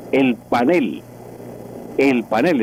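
"panel" as pa-NEL, weight on the last syllable; correct Spanish stress. Shown as a demonstration. Professor drilling the correct form, "panel" stressed at the end. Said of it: "ese es lo correcto en español".

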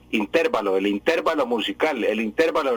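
"intervalo" stressed on TER; mimicked mispronunciation. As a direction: imitate the error, "intervalo" as in-TÉR-va-lo. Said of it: "les da por decir intervalo".

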